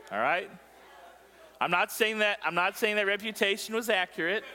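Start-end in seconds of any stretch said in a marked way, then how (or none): none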